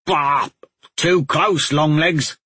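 put-on sputtering gruff old trapper; gruff huffing badger. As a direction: huff and spit the words out. Badger gets a mouthful of moss.

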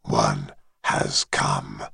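very low, distant dragon voice; deep dragon voice. Acted. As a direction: speak as an ancient dragon, far below. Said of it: "said the dragon".

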